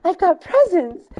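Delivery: put-on high, eager quoted kid; movie character line. Retelling the Christmas scene, high excited imitation. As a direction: imitate a film kid's excitement. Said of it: "And then he's like".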